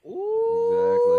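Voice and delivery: high and slow, held out; high drawn-out exclaim. Pretend. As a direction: stretch it up high and long.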